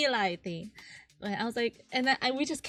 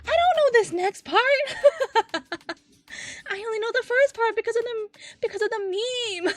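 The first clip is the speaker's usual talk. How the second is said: high, loud, flustered protest; panicked surprise; 13 semitones higher, 10 dB louder than usual. Retold or imitated. Friend caught on the next line, flustered high. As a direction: blurt it out, caught off guard.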